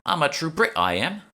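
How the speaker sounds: Cockney, proud and cheeky; Cockney accent. A narrator's reading. Emma claims to be a true Brit, in Cockney. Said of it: "breaking into a Cockney version of her accent".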